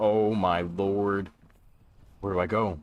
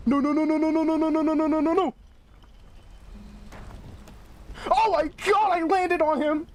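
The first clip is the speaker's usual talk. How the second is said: panicked screaming no; faster than usual. Real, not acted. Streamer caught by the hunter, high panicked shouting.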